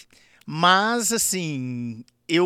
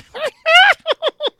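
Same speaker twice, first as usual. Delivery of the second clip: hoarse, much higher, louder cackle with a high edge; Zacarias laugh; 10 dB louder than usual. Shown as a demonstration. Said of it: "eu fiz assim, ó". (gargalhada rouca e aguda, bem alta)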